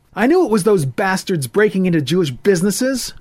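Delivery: put-on high, loud, distressed young man; upset young man. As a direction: pour it out in rising anger and fear. Voices Ethan, upset.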